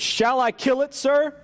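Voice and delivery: high, insistent angel voice; unearthly voice. Acted. Angel asks permission.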